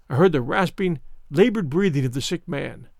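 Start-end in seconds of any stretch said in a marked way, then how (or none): none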